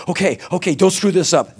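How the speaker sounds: high-pitched anxious self-coaching; nervous self pep-talk. Retold or imitated.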